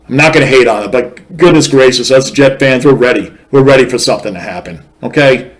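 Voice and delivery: ordinary delivery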